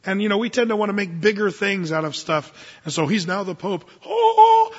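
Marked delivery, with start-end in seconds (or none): 4.1-4.7 mock awe.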